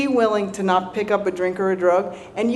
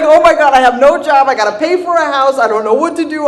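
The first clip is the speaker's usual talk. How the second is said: louder, higher panicked rant; mock panic; 5 semitones higher, 6 dB louder than usual. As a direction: act out freaking out.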